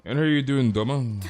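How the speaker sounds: usual speaking voice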